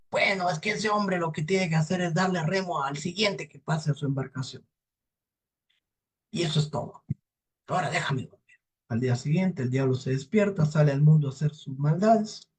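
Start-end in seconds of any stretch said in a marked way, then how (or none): 0.1-8.4 strained devil voice, higher then settling; lightly voiced weary devil wanting sleep; irritable devil. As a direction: hand over the answer grudgingly. Devil gives the answer.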